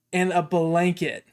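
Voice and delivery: ordinary talk, nothing marked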